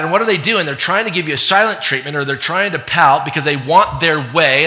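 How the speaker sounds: ordinary talk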